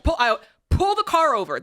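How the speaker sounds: snapping at her husband in the car; frustrated outburst, replayed; retold angry demand. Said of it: "Ken, I lost it".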